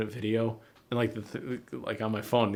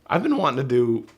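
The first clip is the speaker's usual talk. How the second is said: voice cracking; 2 semitones higher, 6 dB louder than usual. Real, not acted. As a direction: voice breaks upward mid-sentence. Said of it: "voice kinda cracked there".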